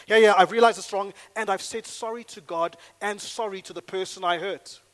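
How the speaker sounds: loud, brushing-off yeah yeah yeah; glib answer. Shown as a demonstration. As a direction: dismiss it quickly and loudly. Fuller answer, loudly acted.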